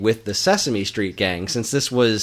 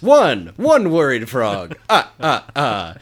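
higher, louder Count impression with his laugh; 4 semitones higher, 4 dB louder, slower than usual. The Count counts one worried frog, Count impression. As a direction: count it out with a vampire chuckle. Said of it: "the Count ... says".

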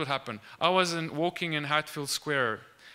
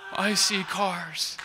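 louder, drawn-out cry of wonder; tearful amazement; 6 semitones higher, 5 dB louder, much slower than usual. Retold or imitated. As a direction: imitate her astonished outburst, slowly. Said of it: "tears started running".

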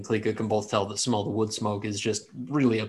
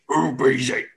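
half-yelled orc alarm; Orcish yell; 3 semitones higher, 4 dB louder, slower than usual. Acted. Orcs spot a stranger approaching camp, mild Orcish yell. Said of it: "they yell it out in Orcish".

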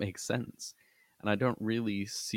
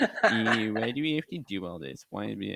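mock whiny complaining; 3 semitones higher, 4 dB louder than usual. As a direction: whine it like a bored fan. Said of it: "that was my whiny voice".

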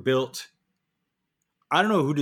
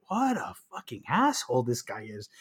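muttered thought; 6 dB quieter than usual. Retold or imitated. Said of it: "they must be thinking".

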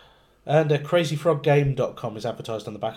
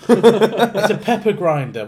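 excited shout; 8 semitones higher, 10 dB louder, slower than usual. Real, not acted. Joking guesses about blurred image.

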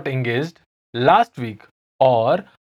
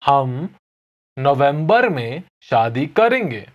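fast, natural हम नवंबर में शादी करेंगे; natural pace; much faster than usual. Shown as a demonstration. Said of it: "I'll say it again slowly".

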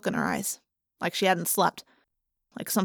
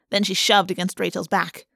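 ordinary delivery, nothing marked

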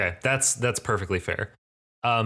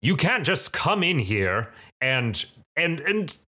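higher, sputtering priestly protest; acted outrage that stumbles for words; very angry; 7 semitones higher than usual. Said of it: "he looks very angry".

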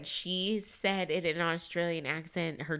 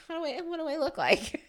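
much higher, fussing over her looks; mock primping girl; 12 semitones higher than usual. Friend mocking Kaylin fussing over her looks at the bar, high mock squeal. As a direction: squeal it like a vain girl checking her face.

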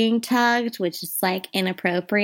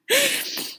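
a sudden high squeal of laughter. Real, not acted. Cracking herself up over her own rainbow joke, squealing laugh.

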